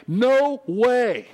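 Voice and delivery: high, loud "no way"; forceful, stressed "no way"; emphatic. Shown as a demonstration. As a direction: punch "no way" as an absolute refusal. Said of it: "it's a very emphatic".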